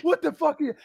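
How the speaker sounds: high-pitched mock yell at normal volume; retold scream. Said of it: "I was screaming".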